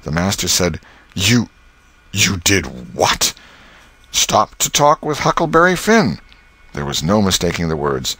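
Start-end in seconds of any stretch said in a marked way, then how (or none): 1.1-3.3 slow, halting disbelief; stunned master. Acted. Master gaping at Tom's confession, slow stunned disbelief. 4.1-6.2 put-on high, louder boy's reckless confession; bold schoolboy. Tom confessing to the master, high and bold.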